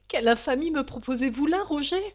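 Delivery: loud, fast, outraged protest; indignant exclamation. A narrator's reading. Valentine accusing Roger of infamy, loud outrage. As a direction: hurl the accusation back at him. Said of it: "s'exclama Valentine".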